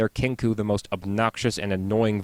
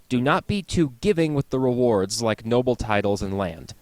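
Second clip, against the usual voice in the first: usual speaking voice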